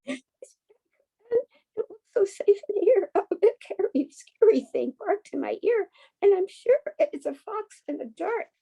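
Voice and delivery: high, trembling little lamb voice; frightened lamb. A narrator's reading. Reading to a child, voicing the lamb: high and scared. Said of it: "a lamb who is shaking with fear".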